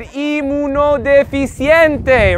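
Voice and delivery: inmunodeficiente slowly, "ee-moo-no-deh-fee-see-en-tay"; slow, even Spanish inmunodeficiente; Spanish pronunciation. Shown as a demonstration. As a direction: model inmunodeficiente syllable by syllable. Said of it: "How do we say immunodeficient".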